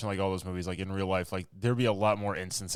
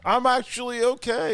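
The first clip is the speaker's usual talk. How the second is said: high, slow mock survivor voice; 6 dB louder, slower than usual. Voicing the injured survivor.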